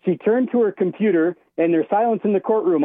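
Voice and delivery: ordinary talk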